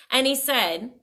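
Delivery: plain reading voice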